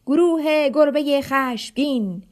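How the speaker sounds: slow, loud, drawn-out rallying shout; kid's slow, loud gang war cry; shouted battle cry. Acted. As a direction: bellow the gang's name like a war cry. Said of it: "آرمان داد زد".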